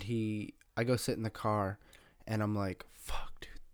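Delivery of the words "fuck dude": hushed, voiceless mock dread; under-the-breath whispered curse; whispered inner thought. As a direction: whisper it to yourself in dismay. Retold panicked thought in the car.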